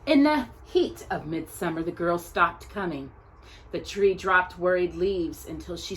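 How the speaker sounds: measured, expressive picture-book reading; story narration. Acted. Teacher reading a picture book about Anne Frank's tree to her class, gentle storytelling. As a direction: read it like a storyteller at circle time.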